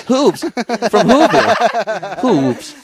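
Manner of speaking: loud, hammed-up mock-Irish; Irish accent. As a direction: belt it out like an Irish guy, laughing. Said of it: "say it like an Irish guy".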